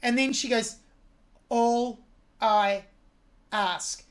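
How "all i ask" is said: All, I, ask each separated; detached words. Shown as a demonstration. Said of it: "she separates those three and detaches them".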